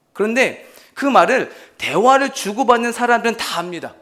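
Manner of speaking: usual speaking voice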